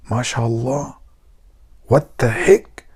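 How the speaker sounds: soft, stunned aside; hushed amazement. Acted. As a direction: murmur in acted awe to your friend. Awed friend reacting in joke.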